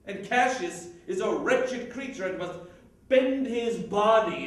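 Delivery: proud, swelling Shakespearean oratory; indignant Cassius. Acted. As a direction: build with scornful disbelief at Caesar's rise. Cassius's speech grows indignant.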